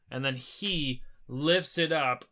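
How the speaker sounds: ordinary talk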